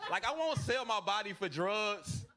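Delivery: normal talking voice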